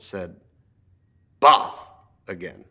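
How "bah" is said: loud scornful bah; gruff scoff. Acted. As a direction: spit it out with contempt. Scrooge scoffs again.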